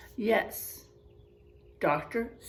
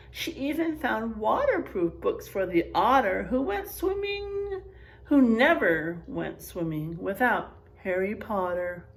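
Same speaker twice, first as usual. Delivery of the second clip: storybook reading; 5 dB louder than usual. Acted. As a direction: paint each animal for the kids. Reading picture book aloud.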